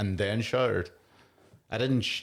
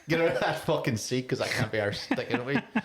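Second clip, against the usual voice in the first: hammed-up rude stranger, higher and pushy; mock hostile brush-off; 7 semitones higher than usual. Retelling a train joke to a friend, mock hostile and higher. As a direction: act out how the quip sounded to her: get lost. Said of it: "it just made me sound like".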